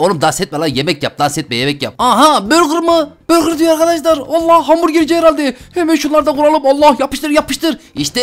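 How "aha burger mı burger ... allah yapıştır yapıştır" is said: excited. Real, not acted. Excited finding a burger stand.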